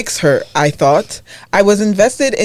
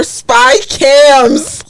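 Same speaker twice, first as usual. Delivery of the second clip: excited shout; 6 semitones higher, 4 dB louder than usual. Real, not acted. Reading the post to friends, shocked outburst at the twist. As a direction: yell the word out high and loud. Punchline of the spy camera story.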